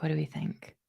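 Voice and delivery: a hushed echo of her own question; soft repeat. Real, not acted. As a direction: drop it to a low, soft murmur. Repeats her question more softly.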